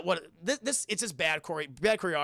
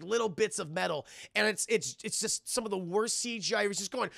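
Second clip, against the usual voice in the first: ordinary talk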